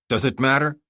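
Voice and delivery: plain reading voice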